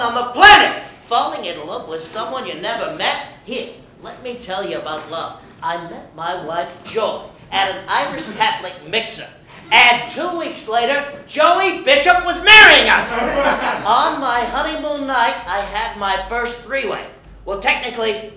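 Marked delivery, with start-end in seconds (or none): none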